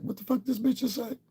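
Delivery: very high squeaky disbelief; a high mock squeal of people in disbelief; baffled onlookers. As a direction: play the stunned crowd, pitch flying up. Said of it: "look back at her like".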